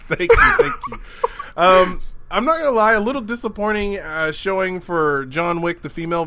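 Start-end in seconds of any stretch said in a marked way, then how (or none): 0.0-1.0 laughing, high thank you. Real, not acted.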